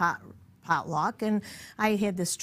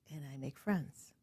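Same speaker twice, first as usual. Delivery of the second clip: ordinary delivery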